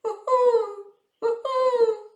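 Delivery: high, clear owl call; owl hoot. Acted. Mother Owl calls the sun, high hoot.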